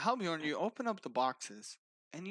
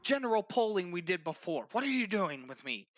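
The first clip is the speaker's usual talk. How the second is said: higher, stammering protest; struggling captive; 5 semitones higher, 4 dB louder than usual. Acted. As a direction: protest as you are hauled away. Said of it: "he's struggling along the whole way".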